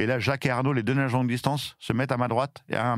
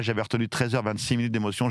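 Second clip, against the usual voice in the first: ordinary talk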